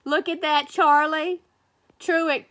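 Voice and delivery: ordinary delivery, nothing marked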